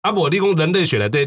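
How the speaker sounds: ordinary delivery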